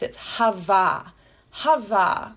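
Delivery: "hava" slow, both vowels open like "hah-vah"; Hebrew word. Shown as a demonstration. Teacher pronouncing Hebrew "hava" for the listener, slow. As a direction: say "hava" slowly, ah-vowels, first syllable stressed. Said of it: "that Hebrew word gets repeated".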